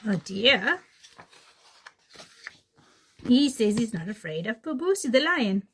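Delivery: usual speaking voice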